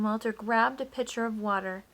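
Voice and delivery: usual speaking voice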